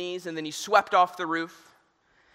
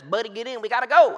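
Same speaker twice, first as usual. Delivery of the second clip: neighbor calls out loud, higher, to get in; urgent call; 5 semitones higher, 6 dB louder than usual. Retold or imitated. Neighbor in a boat calls to man on roof: urgent loud call. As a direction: shout him into the boat. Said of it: "he says".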